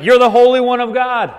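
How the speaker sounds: loud, high acted demon cry; screaming praise. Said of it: "we have demons screaming God's praise".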